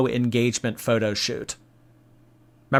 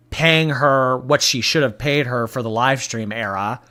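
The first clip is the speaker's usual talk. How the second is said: usual speaking voice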